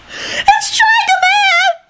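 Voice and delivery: very high, drawn-out shriek; long high-pitched scream of alarm; terrified shriek. A narrator's reading. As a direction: shriek it in horror. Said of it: "shrieked one of the first years".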